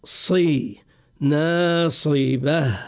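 ناصبة with a light nun and an h at the end, said slowly; correct slow recitation. Shown as a demonstration. Teacher reciting the correct form slowly. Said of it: "فنقول ناصبة".

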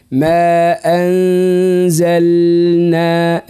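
very slow, drawn-out anzalna with distinct L; clear lam in anzalna. Shown as a demonstration. Teacher demonstrating to his pupil, lam held clearly before nun. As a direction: hold the L, then move into N. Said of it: "ندخل باللام".

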